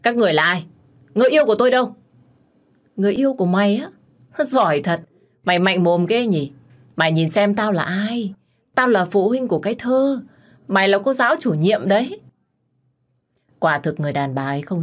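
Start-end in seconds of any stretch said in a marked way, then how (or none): 0.0-1.9 higher and louder, crying out in alarm; panicked shout. Acted. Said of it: "bèn lớn giọng và hét lên". 3.0-12.2 louder, sneering contempt from the angry mother; furious mocking scorn. Furious wife confronts the teacher.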